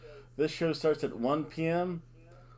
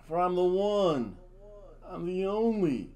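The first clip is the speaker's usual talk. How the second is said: dramatic persona intro; 3 semitones higher, slower than usual. Acted.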